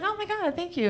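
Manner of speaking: high, breathy-free gushing mock thanks. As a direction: overdo the delighted thank-you.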